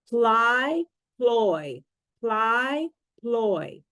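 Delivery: "Ply" then "ploy", set apart with pauses; minimal pair demo. Shown as a demonstration. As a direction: contrast the "eye" vowel in "Ply" with the "oy" in "ploy". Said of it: "pronounced almost the same except for one vowel sound".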